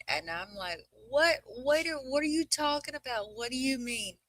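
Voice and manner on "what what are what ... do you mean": high-pitched retold bewilderment; incredulous parent, voice way up; baffled questioning. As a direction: question the kid in mock disbelief, pitched up. Retells her baffled reaction to son.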